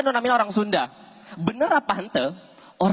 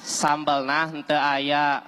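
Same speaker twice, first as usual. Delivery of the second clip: very slow, lower, each word stretched; slow again, drawn out; 7 semitones lower, much slower than usual. Acted. Warteg owner repeats slowly to the customer, drawn-out. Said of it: "Coba ulangi sakali deui".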